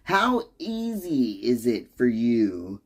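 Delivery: usual speaking voice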